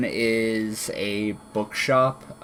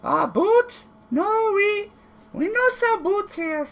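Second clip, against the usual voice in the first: very high squeaky voice, broken grammar; a jittery little kobold bluffing. Acted. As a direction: kobold squeaking back at the armed intruder. Kobold answers in broken speech.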